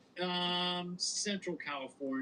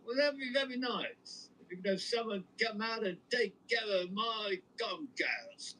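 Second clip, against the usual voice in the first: put-on a higher old-timey movie star voice; Cary Grant voice; 6 semitones higher than usual. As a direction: play a hint of a classic Hollywood leading man on the phone. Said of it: "They just accept Cary Grant completely".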